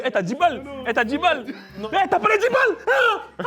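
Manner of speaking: high-pitched mock shakedown, climbing in pitch; mimicked demanding kid. Teacher mocked as a kid demanding ten euros, high-pitched mimicry.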